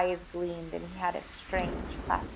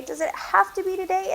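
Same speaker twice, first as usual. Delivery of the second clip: put-on high whiny child; pleading little boy; 11 semitones higher than usual. A narrator's reading. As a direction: beg like a scared kid. Child begs.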